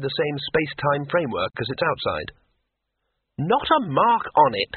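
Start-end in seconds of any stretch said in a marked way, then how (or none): 3.4-4.7 cheerful. A narrator's reading. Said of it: "said Twoflower cheerfully".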